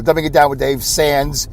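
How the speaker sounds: normal talking voice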